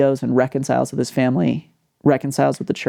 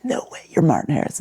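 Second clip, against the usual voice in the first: hushed, starstruck whisper; awed whisper. Retold or imitated. Missionary whispering in awe at meeting Martin. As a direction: breathe it like meeting a hero.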